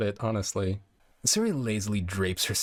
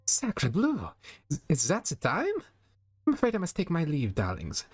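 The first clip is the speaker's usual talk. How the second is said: put-on high, flustered French accent; theatrical alarm, diva style; dramatic French diva; 7 semitones higher than usual. Flower's voice, dramatic gasp.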